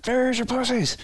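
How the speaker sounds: high mock yell, not full volume; retold yell. Retelling the yell recruits made running through the shop. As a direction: shout it like a hazed private. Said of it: "just yell at the top of your lungs".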